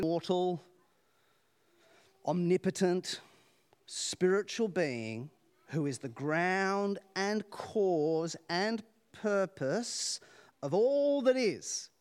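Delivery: very slow, each word weighed; slow solemn emphasis. Acted. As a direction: lay it out slowly like a creed. Solemn description of God.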